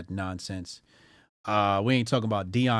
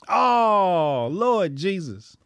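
drawn-out, high mock lament; loud "oh" easing into a slow, scandalized groan; mock horror; 7 semitones higher, 6 dB louder, much slower than usual. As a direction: cry out in hammed-up dismay. Mock horror at the app's sentence.

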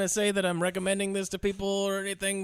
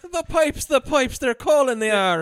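higher, louder stage brogue; sing-song it like a stage Irishman; cartoon Irish accent; 7 semitones higher, 5 dB louder, slower than usual. Riffing on Pitt's accent, loud mock brogue. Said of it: "god-awful accent".

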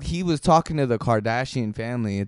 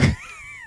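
laughing; 7 dB quieter than usual. Real, not acted. Laughing at the lemonade lyric.

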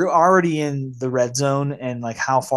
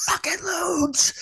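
mock desperate wail; much slower than usual. Quoting a desperate Donnie demanding drugs.

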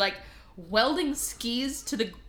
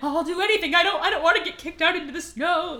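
louder, high, rapid mock panic; freaking out; 6 semitones higher, 5 dB louder, faster than usual. Punch Bowl fearing being thrown out, mock-panicked pleading. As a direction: plead fast in hammed-up panic. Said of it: "he freaks the fuck out".

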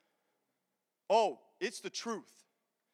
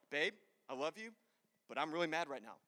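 low, soft retold grumble; subdued grumbling; 4 semitones lower, 11 dB quieter than usual. Husband to his joyful wife on waking mad, subdued. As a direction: confess it quietly, holding back the temper.

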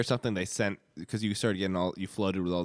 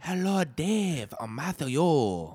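slow, high put-on Chinese accent; mock Chinese accent; 5 semitones higher, much slower than usual. Dalai Lama meets Dave, slow mock Chinese accent. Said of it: "I'll try it out".

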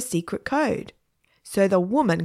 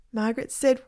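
usual speaking voice

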